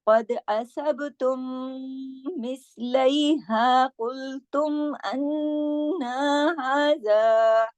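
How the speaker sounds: slow melodic tajweed chant; measured Quranic tilawat with long held notes; Quran recitation. Acted. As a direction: recite slowly, drawing out every vowel. Quran recitation before the lesson.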